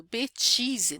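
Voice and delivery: "Bêtise" with chi, Brazilian style; said the Brazilianized way. Shown as a demonstration. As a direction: Brazilian accent on French.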